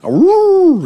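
mock high-pitched cheery howl; silly high woo. Shown as a demonstration. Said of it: "they don't sound like".

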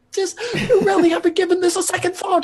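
very high-pitched reproach; scolding Stiffy. Acted. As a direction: keep scolding in a high character voice. Stiffy keeps scolding Ace.

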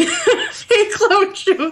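high, lightly voiced bartender with a laugh in it; mock bartender greeting. Game fan retelling the bartender's greeting to her co-host, lightly mocking. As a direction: toss off a high teasing greeting.

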